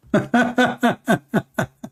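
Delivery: long high-pitched burst of laughter. Real, not acted. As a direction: laugh in a run of high ha-has. Amused by caller saying brother.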